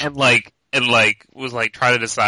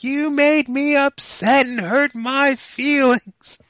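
put-on much higher, very slow wailing little girl; imitating crying daughter; 14 semitones higher, 4 dB louder, much slower than usual. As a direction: stretch it out like a sobbing five-year-old. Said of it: "And she's just crying".

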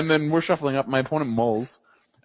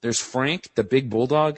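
ordinary delivery

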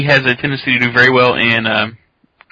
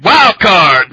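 announcer shout; 6 semitones higher, 5 dB louder than usual. Acted. Announcing a wild card pick.

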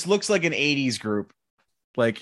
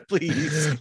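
ordinary delivery

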